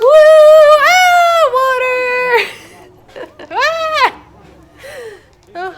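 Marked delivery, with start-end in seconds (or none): 0.0-4.2 high shrieking scream at the splash; excited scream. Real, not acted. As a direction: shriek as the water hits. Splashed on the water ride.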